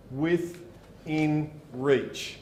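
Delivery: very slow, stretched Within reach; drawn-out repeat. Shown as a demonstration. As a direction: let Within reach hang, word by word.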